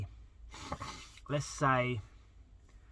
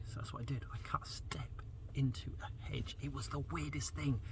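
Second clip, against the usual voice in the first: whispered aside; 13 dB quieter than usual. Real, not acted. Whispered aside about the step.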